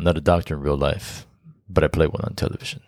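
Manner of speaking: put-on deep heartthrob voice; sexy commercial voice. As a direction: drop it low and smooth like a TV pitchman. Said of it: "so drawn to his voice".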